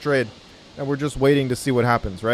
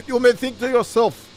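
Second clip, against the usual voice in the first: hammed-up Russian accent, pitched higher; mock Russian accent; 4 semitones higher than usual. YouTube trader opening his video to viewers in a put-on Russian accent. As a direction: lay on a thick joke Russian accent to tease nervous traders. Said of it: "I can't do this accent the whole call".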